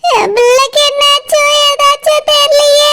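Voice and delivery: drawn-out tearful worry; crying. Acted. Mother leaving for hospital, praying for her child, crying. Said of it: "சிலுப்பா, அழாத சிலுப்பா".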